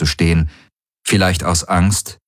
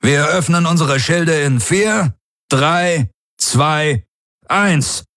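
raised, commanding count; tense countdown; 8 semitones higher, slower than usual. A narrator's reading. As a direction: count down to launch, pitched up. Commander counts down over comm. (angespannter Countdown mit erhobener Stimme)

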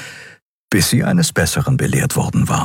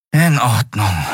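resigned sigh; 3 semitones higher, slower than usual. A narrator's reading. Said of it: "seufzte er resigniert".

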